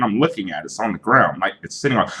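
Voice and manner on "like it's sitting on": ordinary talk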